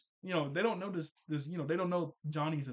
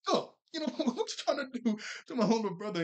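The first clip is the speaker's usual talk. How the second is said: high, louder, laughing confrontation; mock angry sister; 9 semitones higher, 6 dB louder, faster than usual. Sister confronting a bully, in a high laughing mock anger. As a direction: play the protective big sister, hammed up. Jazz defends her brother.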